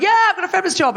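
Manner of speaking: high-pitched imitation of a keen crowd; mock eager boast. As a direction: cheer it out, imitating overconfident volunteers. Imitates audience's confident cheering answer.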